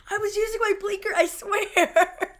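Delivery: high, fast mock-panicked girl voice; panicked teen. Teen driver pleading with the cops, high mock panic. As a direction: plead innocence in a squeaky rush. Julie caught by police.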